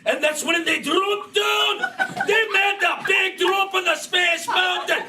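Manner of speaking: high excited shouts; excited shouting. Acted. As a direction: yell it like a ride drop. Excited ride narration.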